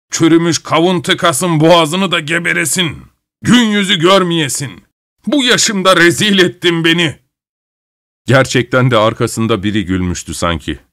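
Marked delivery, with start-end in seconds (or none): 0.1-7.2 mock angry curse. Grandfather cursing the devil aloud.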